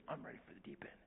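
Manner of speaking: put-on whispered little boy's thought; whispering. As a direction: whisper it secretly. Repeated secret thought.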